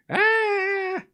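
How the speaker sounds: short, high hammed-up shriek; mock scream. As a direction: imitate someone bursting in screaming. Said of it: "Come in here screaming and shouting".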